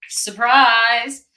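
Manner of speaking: mock cheerful surprise, loud and bright; sarcastic surprise. Sarcastic reveal of rigged election.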